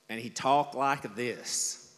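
slow, drawn-out Southern drawl. Retold or imitated. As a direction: stretch each word like a good old country boy. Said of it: "He's got that southern drawl".

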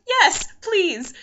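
high, loud, eager acceptance; retold eagerness. Newcomer offered tea at the mosque, loudly eager. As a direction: jump at the offer.

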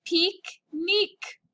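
pique-nique slowly, in two crisp French syllables; pique-nique as a careful French model; French pronunciation. Shown as a demonstration. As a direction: stretch out pique-nique, both vowels "ee". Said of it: "when you pronounce it in French".

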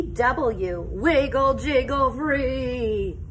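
ordinary delivery

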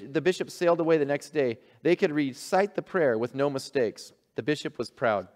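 usual speaking voice

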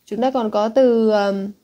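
ordinary delivery

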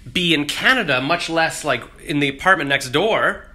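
ordinary talk, nothing marked

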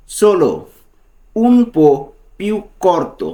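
Italian phrase "Solo un po' più corto" said slowly, one word at a time, for the learner; slow Italian model phrase. Shown as a demonstration. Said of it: "তো এর Italian কী হবে?".

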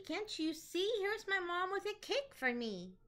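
high, bright monkey glee; excited monkey. Acted. Monkey shows the lion his mom's cake, high and thrilled. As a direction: squeal it like a thrilled little monkey. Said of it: "said Monkey".

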